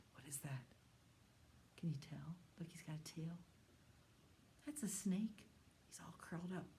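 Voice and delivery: hushed whisper about the curled-up snake. Acted. Teacher whispering to children over a sleeping snake. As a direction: whisper so the snake stays asleep.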